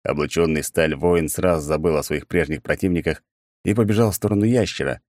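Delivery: ordinary delivery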